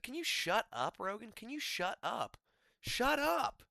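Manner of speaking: high, whiny shut up; exasperated. Real, not acted. Fan telling Rogan to shut up, exasperated and high. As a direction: beg him to stop, fed up.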